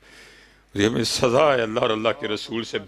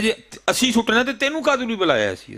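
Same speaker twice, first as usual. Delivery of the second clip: high-pitched, indignant mimicry; retold angry retort; 12 semitones higher than usual. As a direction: act out the son's angry challenge, voice jumping up. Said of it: "بڑے غصے میں کہتا ہے".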